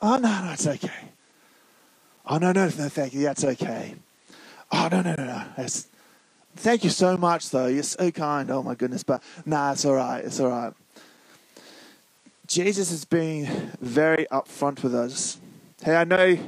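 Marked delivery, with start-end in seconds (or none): 0.0-10.7 mock bashful grown-up waving off an offer; polite adult. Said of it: "Where you have an adult that's like".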